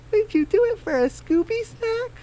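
squeaky-high storybook hen asking the group to split up; high Daisy voice. Acted.

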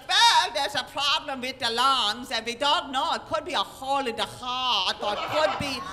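loud, hammed-up Germanic doctor; German-sounding accent. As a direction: boom it out in a mock German accent, drawing out words. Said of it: "all I hear is German".

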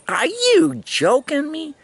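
high, slowed disbelieving question; mock shock. Learning the lady was the police sergeant, in high slow mock disbelief. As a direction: play the dawning horror.

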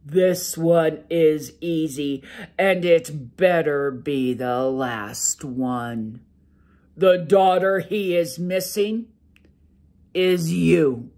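a sleepy giant ogre grumbling to his wife; loud ogre brushing off his wife's worry. Acted. Ogre answers, fed up.

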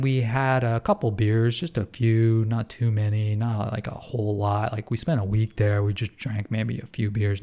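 ordinary talk

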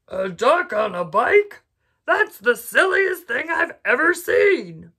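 slow, loud, astonished cow voice; amazed cow. A narrator's reading. Cow marvelling at the duck on a bike, loud and slow. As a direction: stretch it out in disbelief. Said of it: "what she thought was".

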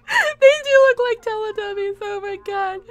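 high, loud, squeaky laughing cry; crying with laughter. Real, not acted. Streamer crying with laughter at bear suits. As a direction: lose it, words squeezed through tears.

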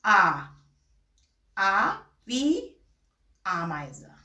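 slow A wie Ameise with German A; slow recap; slow German letter repeat. Shown as a demonstration. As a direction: model the letter again slowly. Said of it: "پس".

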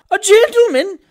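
high, loud lady's shriek; terrific scream. A narrator's reading. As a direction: scream it in horrified alarm. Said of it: "with a terrific scream".

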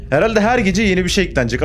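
ordinary delivery

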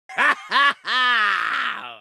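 a big triumphant laugh, high. Acted. Villain gloats.

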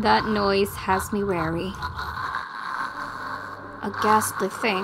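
ordinary talk

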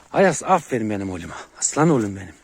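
loud, proud praise to a dog; hearty praise. Real, not acted. Owner praises his dog for getting along, loudly. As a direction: call out praise at full voice.

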